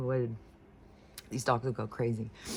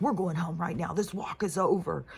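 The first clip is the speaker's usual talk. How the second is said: higher, retold angry order; fed-up scolding, pitch raised; pissed off; 5 semitones higher than usual. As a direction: lay down the law, re-enacting it. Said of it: "I was, like, so pissed".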